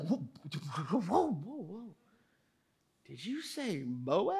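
freeze at the name in mock awe; stunned disbelief.

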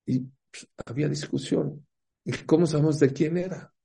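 normal talking voice

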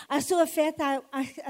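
ordinary talk

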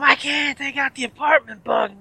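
imitated neighbour protesting at high pitch; high mock whine of refusal; paranoid neighbour. Neighbour refusing to head home, high paranoid mock protest.

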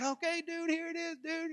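high-pitched mock cowboy dude; cowboy type. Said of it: "kinda cowboy".

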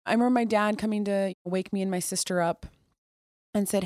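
ordinary delivery

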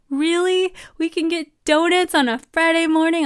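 high, loud excited question; childlike joy at a treat; husband thrilled about Friday donuts; delighted husband. Retold or imitated.

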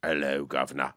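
very low, soft London impression; Idris Elba. Comic claiming to be James Bond, deep London impression. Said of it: "Idris Elba doing Michael Caine".

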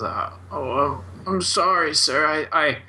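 a stammering, flustered old wizard, lightly voiced; stuttering old wizard. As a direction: stammer out the apology nervously. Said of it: "mumbling and sort of stuttering".

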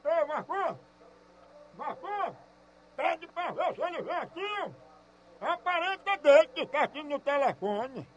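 calling out to someone across the room, away from the phone. Real, not acted. Caller calls out to Marcos away from the phone. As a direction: turn away and call for Marcos.

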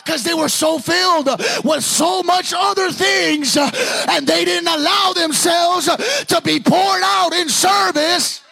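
high, shouted pulpit cry; full-force preaching. Acted. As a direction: preach at the top of your voice, drawing out key words.

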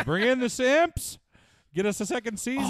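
high, loud pretend command; playful showrunner summoning the crowd; mock rallying call. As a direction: call it out like a hammed-up order. Playing showrunners calling for viewers.

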